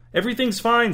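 high-pitched, hurried-to-placate reassurance; anxious reassurance. A narrator's reading. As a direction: insist nervously, pitch jumping well up. Servant placates suspicious master.

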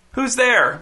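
high, thin, wary call; thin voice pitched well up; thin, whiny. A narrator's reading. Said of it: "thin and a little whiny".

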